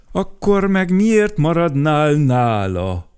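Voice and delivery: gruff man growling a challenge. Acted. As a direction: snap it at the flower gruffly. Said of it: "mordult rá".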